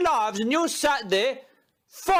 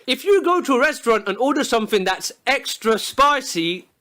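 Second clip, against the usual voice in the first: ordinary delivery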